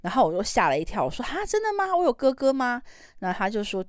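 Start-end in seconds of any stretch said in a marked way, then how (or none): 1.2-2.8 high, astonished "really?"; replaying her childhood shock, pitched up high; startled disbelief. Girl told she has a brother, asks in high astonishment.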